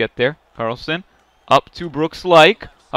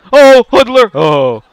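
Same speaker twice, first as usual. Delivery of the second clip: excited yell; 8 semitones higher, 8 dB louder, slower than usual. Real, not acted. Big hit in hockey game.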